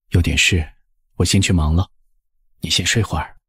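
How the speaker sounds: low, gentle husband soothing; husband's low voice. Acted. Husband soothes his drowsy wife, low and hushed. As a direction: keep it low and hushed for her. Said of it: "拍着她的背说".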